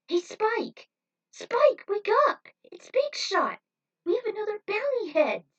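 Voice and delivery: high, loud, eager calls to wake him; excited shouting. Acted. Trudy tugs at sleeping Spike's arm, shrieking high and loud. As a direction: yank him awake with squealing excitement.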